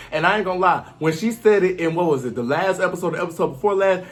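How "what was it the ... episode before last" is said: ordinary talk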